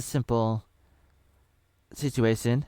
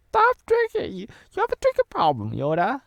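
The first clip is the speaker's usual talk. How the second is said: high mock scolding; 4 dB louder than usual.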